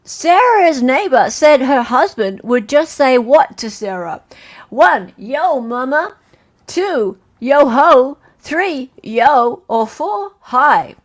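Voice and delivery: loud, emphatic quiz-question reading; quiz host. Acted. Host reads a question to online players, quiz host. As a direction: read it out like a quiz host, punching the option numbers.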